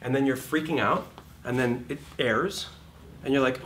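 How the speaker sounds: normal talking voice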